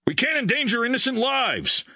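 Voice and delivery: high-pitched, insistent objection; emphatic major. A narrator's reading. Major overrules his crewman, emphatic.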